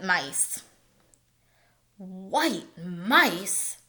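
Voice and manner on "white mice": a touch of mock scorn, lightly voiced; mildly scornful question. A narrator's reading. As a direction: scoff at the idea, understated. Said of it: "said Ralph scornfully".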